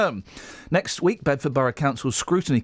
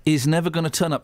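ordinary talk, nothing marked

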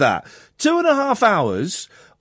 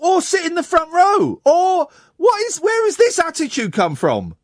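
very high-pitched exasperated disbelief; spluttering indignation; indignant; 10 semitones higher than usual. Real, not acted. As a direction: demand an explanation, voice jumping up high. Host scolds caller for attitude.

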